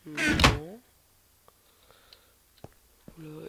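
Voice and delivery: normal talking voice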